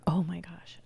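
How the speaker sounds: breathy whispered aside. Real, not acted. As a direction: whisper it in awe. Excited aside about Gary Vee.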